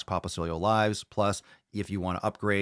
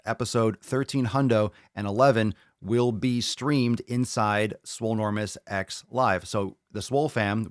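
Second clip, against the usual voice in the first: ordinary talk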